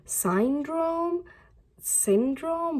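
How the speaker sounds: "Syndrome?" asked twice with a high, puzzled rise; unsure, questioning "Syndrome?". Retold or imitated. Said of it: "and now you're like".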